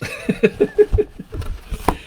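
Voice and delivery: a breathless squeaky giggle; high giggle. Real, not acted. Giggling with delight at the gift from Ray.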